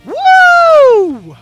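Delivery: ordinary delivery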